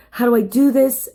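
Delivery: ordinary talk, nothing marked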